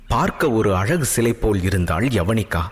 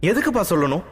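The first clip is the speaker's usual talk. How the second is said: high, fast, near-tears plea; hint of crying while protesting; tearful voice; 10 semitones higher, faster than usual. A narrator's reading. Daughter pleads with her father, high, fast, a touch tearful. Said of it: "என்றாள் அழுகை கலந்த குரலில்".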